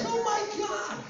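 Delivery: high-pitched mock dismay; retold dismay. Actor hearing about the movie, high mock dismay. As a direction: squeal it as an exaggerated retold reaction. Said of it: "And I'm like".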